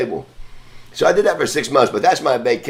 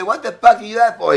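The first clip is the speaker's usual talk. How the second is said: raised high, mock furious demand at the old man; retold angry yell; 9 semitones higher than usual. To the old man who tossed his gold, angry yell. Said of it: "I'm mad as fuck".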